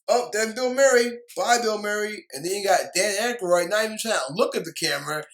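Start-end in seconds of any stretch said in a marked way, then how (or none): 0.1-2.3 a louder, higher mock send-off for Bill Murray; mock farewell. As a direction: wave him off loudly, with mock cheer. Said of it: "He just fly out the window".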